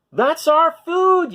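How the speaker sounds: high, loud, slow yell. A narrator's reading. Apatosaur yelling at the Minosaur, high and loud. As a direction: shout it slowly in outrage. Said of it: "yelled Apatosaur".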